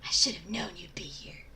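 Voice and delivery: angry voiceless whisper; whisper yelling. Acted. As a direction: seethe it in a whisper. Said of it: "he whispered angrily".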